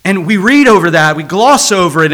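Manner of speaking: ordinary talk, nothing marked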